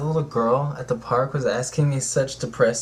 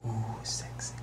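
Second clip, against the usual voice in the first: teasing whisper; 13 dB quieter than usual. Pretend. Whispered tease.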